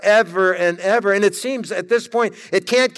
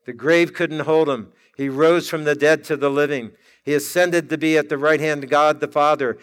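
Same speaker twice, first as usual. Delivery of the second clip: ordinary delivery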